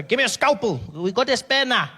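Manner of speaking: high, loud, careless surgeon voice; a shabby doctor calling for a spare scalpel; hammed-up doctor. Doctor voice in a broke public hospital, loud and high. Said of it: "There's the doctor".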